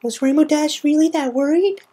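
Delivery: put-on startled child peering out from hiding; Scootaloo, sniffly and startled; teary Scootaloo.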